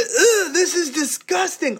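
high-pitched mock disgust; disgusted bewilderment. Counselor watching him eat it, high acted disgust. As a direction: recoil with a high, grossed-out "ugh". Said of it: "I'm bewildered watching this".

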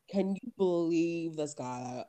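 slow, drawling ditzy voice; dumb blonde. Retold or imitated. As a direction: stretch it out in airhead disbelief. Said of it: "dumb blondes".

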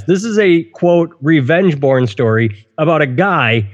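ordinary delivery

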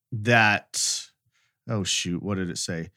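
usual speaking voice

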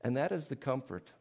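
usual speaking voice

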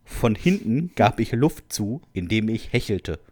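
usual speaking voice

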